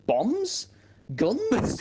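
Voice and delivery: high-pitched mock guesses, each a question; bewildered guessing. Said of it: "I'm thinking".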